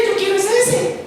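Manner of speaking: high whiny voice wanting that one; whiny covetous child. Retold or imitated. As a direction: whine like a greedy child.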